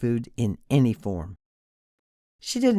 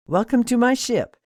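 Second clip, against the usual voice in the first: higher, louder gushing welcome; second welcome in broad Midwest twang, pitched up; excited Midwest twang; 9 semitones higher, 6 dB louder than usual. A narrator's reading. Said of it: "in a broad Midwest twang".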